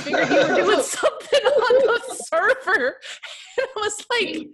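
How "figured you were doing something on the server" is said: laughing through quote. Retold or imitated. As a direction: quote dissolving into high, loud laughter. Quoting Karen, breaking into laughter.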